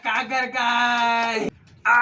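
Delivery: high, slow, stretched call; drawn-out announcement. Real, not acted. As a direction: announce it like a game show. Announcing the string's prize. (నెమ్మదిగా సాగదీసిన ఉత్సాహపు ప్రకటన)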